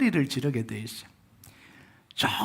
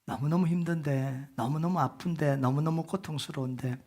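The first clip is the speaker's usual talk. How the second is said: usual speaking voice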